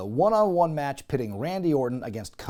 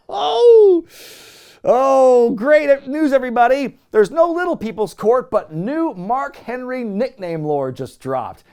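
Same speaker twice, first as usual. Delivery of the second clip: much higher, louder gleeful cry; delighted outburst; 10 semitones higher, 9 dB louder than usual. Real, not acted. Host telling viewers the news, giddy announcement.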